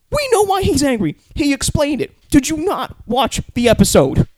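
high, loud incredulous yelling; exasperated shout. Real, not acted. Viewer shouting at a host who missed the episode, exasperated. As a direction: yell it at a clueless host.

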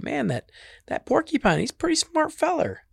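high-pitched admiration; impressed trickster. Acted. Trickster watching the porcupine's trick, impressed.

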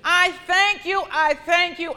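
preacher leads loud thanks; loud praise. Shown as a demonstration. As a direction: call it out in praise. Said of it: "begin to praise the Lord".

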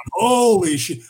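loud high astonished exclamation; amazed. Retold or imitated. Bandmates stunned by his singing, loud amazed exclamation. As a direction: let it burst out in shock. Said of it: "just went".

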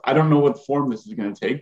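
ordinary talk

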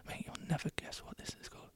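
breathy conspiratorial whisper; hushed, secretive whisper. Shown as a demonstration. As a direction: lean in and whisper the tease.

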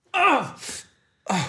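high cry of pain. Acted. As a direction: yelp as wax burns. Wax in nose.

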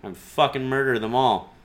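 usual speaking voice

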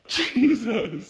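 high, loud outburst; loud exclamation. Real, not acted. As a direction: burst out loudly on a high pitch. Reacting to co-host's laughing interruption.

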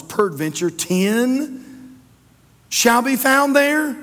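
slow, rising high and loud; high, loud, slow appeal; drawn-out scriptural plea. Acted. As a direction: stretch the plea out, climbing to a ringing finish. Reads Abraham's last plea aloud.

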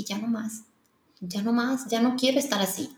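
quietly decide, almost under the breath; soft firm "ya no más"; quiet resolve. Retold or imitated. Retold quiet resolution to herself.

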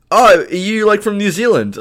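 high, loud mocking question voice; a viewer asking if he is from New Zealand, mimicked high and loud; mock clueless viewer.

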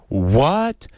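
a lightly voiced shocked question; mild rising what of disbelief; Oprah shocked. Oprah reacting to the baby comments, mildly shocked. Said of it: "Oprah says".

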